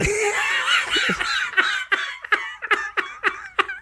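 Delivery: very high squealing laugh; shrieking laugh. Real, not acted. Cohost cracking up over Whitey Bulger, high shrieking laugh.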